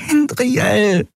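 high, drawn-out plea; despairing high-pitched appeal; desperate pleading. A narrator's reading. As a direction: beg by wailing the name. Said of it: "flehte Nelen verzweifelt".

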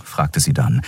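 usual speaking voice

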